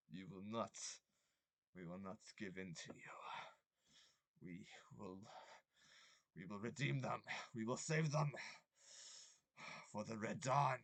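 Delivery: put-on chanting in a Slavic accent; Slavic-ish accent. Game master voicing distant cultists chanting, Slavic-ish accent. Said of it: "Slavic-ish accent".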